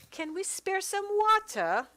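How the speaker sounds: put-on spur-of-the-moment character accent for Mary Jane; sudden odd accent. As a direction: pitch "water" way up in a strange accent, "wɑtə" with no r. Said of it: "I don't know where that accent came from".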